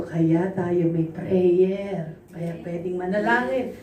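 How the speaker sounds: low, slow counsel. Retold or imitated. Pastor quoting her own counsel.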